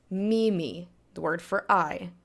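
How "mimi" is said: "mimi" stressed on the first mi; second-to-last syllable stress. Shown as a demonstration. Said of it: "Emphasis goes on the second to last syllable".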